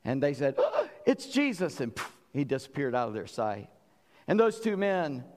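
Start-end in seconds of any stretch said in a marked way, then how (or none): 0.6-1.8 high, slow gasp of wonder; amazed recognition. Retold or imitated. Disciples at the table suddenly see who their guest is, awestruck. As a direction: let it dawn on you, awestruck.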